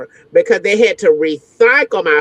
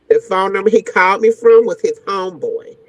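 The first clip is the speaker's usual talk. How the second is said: ordinary talk, nothing marked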